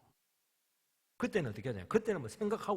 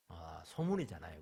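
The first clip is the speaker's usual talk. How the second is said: normal talking voice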